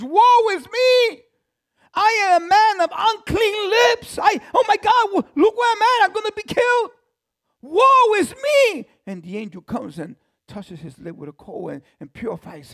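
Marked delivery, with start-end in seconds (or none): 0.0-8.9 terrified Isaiah. Acted. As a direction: wail like a man undone. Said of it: "the first thing he says is".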